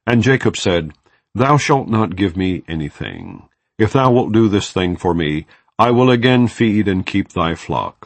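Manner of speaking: usual speaking voice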